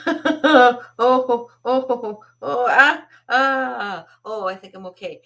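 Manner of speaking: high, loud mock moans of pain; hammed-up groaning. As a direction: ham up the hurt hand with oh, ah. Janitor faking pain.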